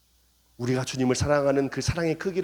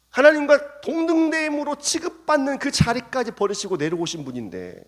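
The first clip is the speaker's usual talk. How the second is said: normal talking voice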